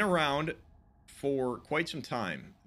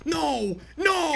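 loud higher desperate cries; panicked yell; 12 semitones higher, 5 dB louder than usual. Real, not acted. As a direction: scream no in panic. Panic as character dies.

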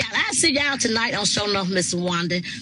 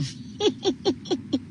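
high, softer staccato giggle; 9 semitones higher, 5 dB quieter than usual. Real, not acted. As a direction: giggle in a quick high string. Live streamer laughing at comments.

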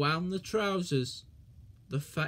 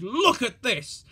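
loud angry shout; 4 semitones higher, 6 dB louder than usual. A narrator's reading. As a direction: scold loudly. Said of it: "he shouted".

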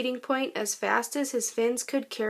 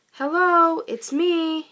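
high, loud call to a friend ahead; 5 semitones higher, 5 dB louder, slower than usual. Acted. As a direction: shout hopefully across the water. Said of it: "he called".

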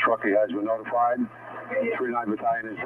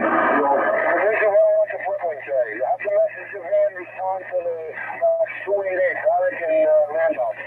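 firefighter shouting through mask; 6 semitones higher than usual. Real, not acted. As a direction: shout over the fireground noise. Field chief calling in over radio.

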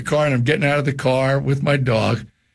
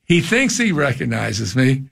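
normal talking voice

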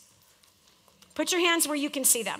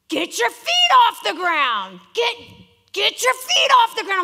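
coach yelling; 8 semitones higher, 7 dB louder than usual. Retold or imitated. Volleyball coach orders the player to jump, loud and high. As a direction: bark it at a player at the net. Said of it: "So for blocking".

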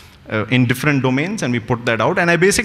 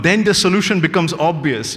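usual speaking voice

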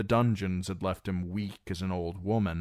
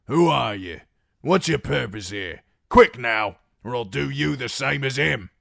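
loud, higher, hostile demands; threatening shout; 7 semitones higher, 9 dB louder than usual. Acted. Guard with a knife at his throat threatens Reek, angry shout. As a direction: bark the questions with a knife ready.

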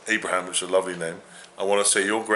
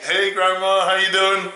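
cheery greeting; 10 semitones higher, 6 dB louder, slower than usual. Real, not acted. Greeting friend's grandma on video.

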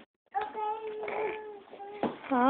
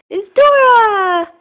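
excited; 7 semitones higher, much slower than usual. Real, not acted. Excited shout showing kid's shoes.

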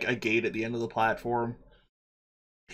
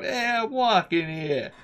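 higher, drawn-out street yell; New York shout; 10 semitones higher, slower than usual. Acted. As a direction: holler it like an annoyed New Yorker. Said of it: "at least one person shout".

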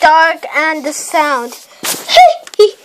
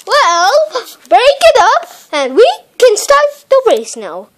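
normal talking voice

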